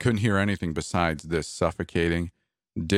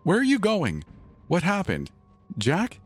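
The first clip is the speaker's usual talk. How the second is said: alarmed wife; 12 semitones higher than usual. Acted. Wife calls after husband.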